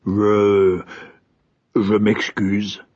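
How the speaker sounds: slow, halting, awkward apology; a flustered king excusing himself; embarrassed. A narrator's reading. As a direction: stumble over the apology, embarrassed. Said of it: "dit le roi, gêné".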